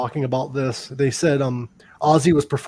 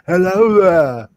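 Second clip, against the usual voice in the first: slow, loud [name] drawl; 4 semitones higher, 6 dB louder, slower than usual. Retold or imitated. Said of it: "And [name]'s like".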